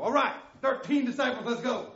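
preacher mocking a Jesus who says yes; mock cheerful Jesus. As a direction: ham up an eager yes.

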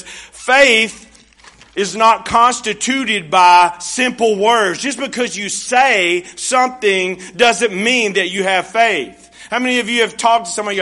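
loud, high, fervent preaching; full-force pulpit warning. Acted. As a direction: drive it home to the congregation. Preacher builds to main point.

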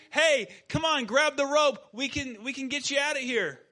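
rescuer shout. Acted. Coast Guard rescuer yells to the man on the roof, loud and urgent. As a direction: yell to the man on the roof to grab the rope.

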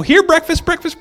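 high mock call of breakfast; playful Jesus calling breakfast. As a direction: lightly voice Jesus summoning the fish.

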